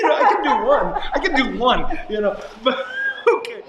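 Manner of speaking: laughing. Real, not acted. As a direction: chuckle out the boast that he could do it. Joking he could be principal.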